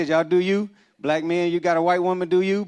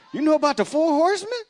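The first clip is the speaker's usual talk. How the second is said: much higher, eager innocent question; high-pitched fake innocence; 13 semitones higher than usual. Single man lying to friend's wife, high and eager. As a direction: pipe up sweetly to sell the lie. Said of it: "I was like".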